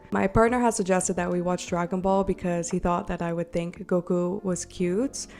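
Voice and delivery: ordinary talk, nothing marked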